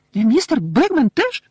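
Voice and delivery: high, loud, slow question; startled little elf; squeaking elf. A narrator's reading. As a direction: squeal it in surprise. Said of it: "запищала Вінкі".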